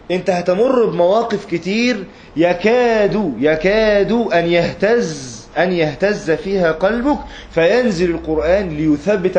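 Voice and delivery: very slow, high and loud; impassioned preaching. Acted. Preacher voices God reassuring the Prophet, slow, high and fervent. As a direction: stretch every word with fervent weight.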